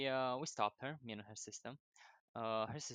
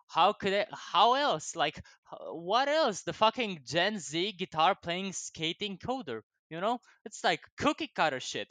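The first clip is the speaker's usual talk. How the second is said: excited rant; 6 semitones higher, 9 dB louder than usual. Real, not acted. Mocking own stereotype, hyped.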